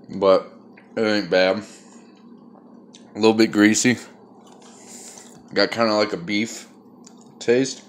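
talking with a phone in his mouth; phone held in teeth. Real, not acted. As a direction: speak around a phone clenched in teeth. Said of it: "hold the phone in my mouth".

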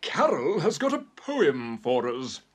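high, loud settling-down voice; scolding hamster minder. Acted. Minder hushing bickering hamsters, high and firm. Adult breaks up hamsters' squabble.

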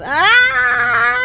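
sudden loud high yell; excited shout. Real, not acted. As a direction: shout it out at the crash. Cheering a race crash.

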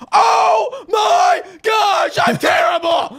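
very high, drawn-out wail; real high-pitched meltdown over a lost game; anguished shout. Real, not acted. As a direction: scream in despair at your own failure. Falls late in the game.

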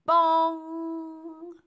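loud, high bong with a long ringing hum; sung-out bell strike, held on the ng; ringing bell imitation. A narrator's reading. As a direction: strike it hard and let it ring.

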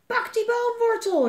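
high, loud, drawn-out yell; slow, high emergency shout; urgent shout. A narrator's reading. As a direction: call out a warning with full force. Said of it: "riep Katja".

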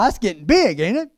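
ordinary talk, nothing marked

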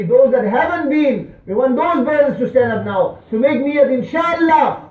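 loud, high exhortation; emphatic rallying sermon voice; impassioned preaching. Acted. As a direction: urge the congregation forcefully. Preaches, calls brothers to stand.

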